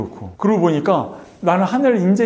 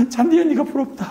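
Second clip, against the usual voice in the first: high, whimpering imitation of crying; re-enacted sobbing lament; mock tearful wail; 9 semitones higher than usual. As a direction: wail enviously at the grass. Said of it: "잔디를 붙잡고 막 웁니다".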